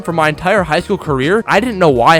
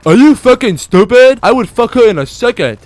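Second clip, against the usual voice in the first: loud imitation of incredulous guys; mock indignant listeners; 4 semitones higher, 5 dB louder than usual. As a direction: push it loud, mocking their outrage. Mocking listeners' indignant reply.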